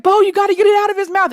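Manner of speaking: high, fast, frantic retold yelling; hysterical. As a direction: plead in a panicky high rush. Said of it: "I'm screaming hysterical".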